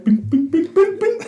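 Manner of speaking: high little rising bings; imitated bing noises. Imitates the snowman being unplugged.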